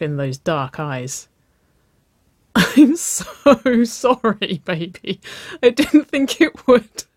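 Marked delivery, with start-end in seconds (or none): 2.6-7.0 high, loud, gasped-out apology; laughing apology. A narrator's reading. Waverley apologising to her batter-covered girlfriend, helplessly amused. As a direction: squeal sorry through amusement. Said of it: "she laughed, her eyes beginning to water in her amusement".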